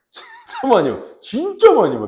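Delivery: high, loud burst of excitement; almost squealing about the fish; excited. Real, not acted. Excited retelling of fish swarming.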